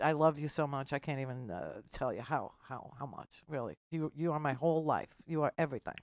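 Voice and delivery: soft, fading, near tears; choked up. Real, not acted. Host thanking a longtime caller, softly choked up. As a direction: voice dropping away with emotion.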